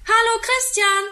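put-on high, loud child's call from the window. A narrator's reading. Boy calls to his friend from the window, high loud call. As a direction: call across the street to a friend. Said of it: "ruft er aus dem Fenster".

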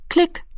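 high, short click imitation; clicking sound. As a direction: snap out a tiny click like a dial. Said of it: "machte es".

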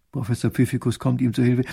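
plain reading voice

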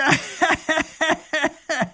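high booming jeering laugh; robber's mocking laugh. Robber laughs at the tiny grand duke. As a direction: laugh in the duke's face.

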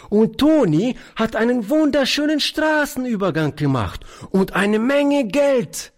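put-on very high, delighted little girl; voll Entzücken. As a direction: brag excitedly in a small child's high voice. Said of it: "rief die Kleine voll Entzücken". (begeisterte Kleinkindstimme)

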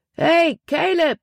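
high, slow shout across the crowd; calling out. A narrator's reading. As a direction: call to a friend over the crowd. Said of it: "rief er". (Nate ruft laut über die Menge)

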